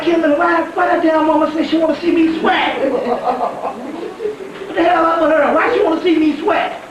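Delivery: can't believe it, cracking up while asking; retelling a call to Kimberly, laughing in disbelief. Retold or imitated. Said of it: "And I'm like".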